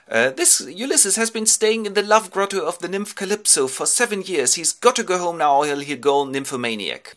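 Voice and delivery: put-on high, loud teenage daughter's plea; Athena. Athena pleads with Zeus for Ulysses, high teenage voice. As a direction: complain to Daddy in a high, insistent girl's voice. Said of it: "his teenage daughter Athena".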